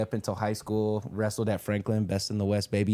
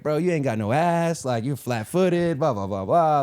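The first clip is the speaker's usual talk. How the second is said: trash talk; 5 semitones higher, 4 dB louder, slower than usual. Retold or imitated. Imitating homie Holiday's trash talk.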